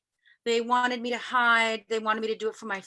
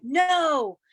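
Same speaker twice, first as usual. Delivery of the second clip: loud, higher, drawn-out retold no; raised-voice mock protest; scared refusal; 5 semitones higher, 5 dB louder than usual. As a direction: re-enact a frightened outright refusal. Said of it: "I was so scared. I went".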